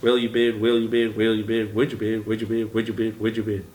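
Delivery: "will you bid" and "would you bid" chanted in quick rhythmic repeats; filler word drill. Shown as a demonstration. Auctioneer drilling viewers on filler words in a chant. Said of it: "Practice that".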